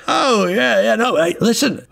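high, hasty backpedal; Millie in character. Acted. Millie sees something here, high flustered voice. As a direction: pitch it way up, stumbling over "yeah, no". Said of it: "He's like".

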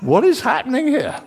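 high-pitched amazed question; astonished. Acted. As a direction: act out a disciple's astonishment. Acting out an amazed disciple.

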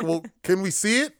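high, loud polite request; mock Arthur. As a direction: Arthur asking hopefully. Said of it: "And he's like".

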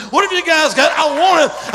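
high loud shout. Retold or imitated. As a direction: yell it out hungrily. Pastor shouting demand retold.

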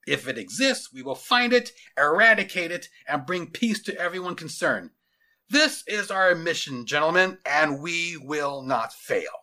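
loud, weighty, deliberate military address; resolute commander. Acted. Commander briefing his men on their mission, resolute and forceful. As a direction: lay down the mission with firm conviction.

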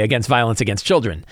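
ordinary delivery

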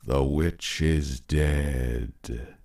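slow, solemn low chant; intoned. A narrator's reading. Husband opening the witch game, slow solemn intoning. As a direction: intone it like a ritual. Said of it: "intoned the husband".

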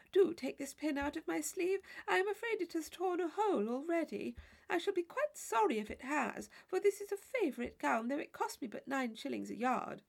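high, fluttery matron's voice, fretting; a silly chaperone worried over her gown; fussy Mrs Allen. Acted. Chaperone fretting to Catherine about her torn sleeve, high and fussy.

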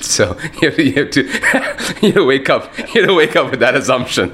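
words broken by laughter; laughing. Real, not acted. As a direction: laugh your way through it. Laughs through his answer.

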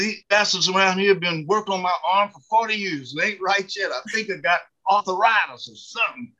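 high-pitched mock grumbling; complaining church lady. Church lady complains to Bill about her arm, grumbling. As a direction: imitate her airing a long-held grievance.

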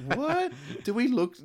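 very high, loud squeak of disbelief; mock incredulous. Tourist taken for a drug user, exaggerated incredulity. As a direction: shoot the pitch right up in mock shock. Retelling shock at apparent drug tip.

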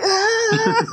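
high mock wail through laughter; imitated agonized cry. Imitating the melting man as he sits there, high mock cry. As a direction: pitch up a laughing "ah" as the suffering victim. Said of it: "he's sitting there like".

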